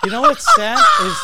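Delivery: high, loud laughing words; words bursting with laughter. Real, not acted. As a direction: squeal it out through laughter. Laughing while starting to speak.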